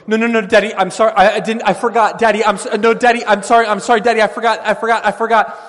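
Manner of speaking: put-on high, loud, frantic begging child; pleading child. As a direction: beg Daddy desperately, high and loud. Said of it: "he's going".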